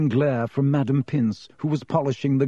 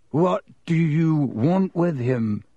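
slow, higher, frightened schoolboy; quavering; 4 semitones higher, slower than usual. A narrator's reading. Boy confronted by the one he fears, quavering. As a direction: draw the question out nervously. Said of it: "said Ernie in a quavering voice".

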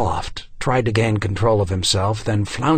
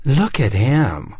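slow, raised-pitch sneer; hissed taunt; 4 semitones higher, 4 dB louder, slower than usual. A narrator's reading. Fashionista pointing him out to others, hissed and slow. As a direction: draw it out as a scornful hiss. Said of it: "one of the fashionistas hissed".

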